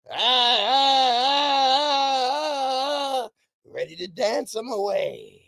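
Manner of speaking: hammy karate yelps; karate yells. Retold or imitated. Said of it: "practicing his karate moves".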